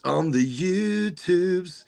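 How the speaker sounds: sing-song the YouTubes, savouring it; drawn-out playful echo. Pretend. Host teasingly repeats cohost's phrase, slow and sing-song.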